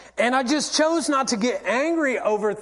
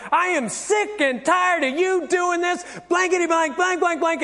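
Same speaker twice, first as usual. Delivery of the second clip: higher-pitched, fed-up mock tirade; acted argument rant; 7 semitones higher than usual. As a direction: rant as in a home quarrel, hammed up. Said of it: "you're in an argument".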